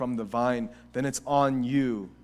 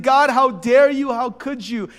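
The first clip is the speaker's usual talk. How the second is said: high, loud, indignant cry; shouting at God; 13 semitones higher, 14 dB louder than usual. Acted. Believer confronting God after a loss, shouting back. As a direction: shout it accusingly to heaven. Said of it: "a shouting back at God".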